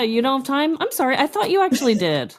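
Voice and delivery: ordinary talk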